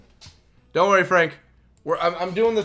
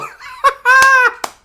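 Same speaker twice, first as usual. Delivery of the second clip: very high squealing laugh; shrieking laugh; 12 dB louder than usual. Real, not acted. Streamer cracks up at the dancing player, shrieking laugh.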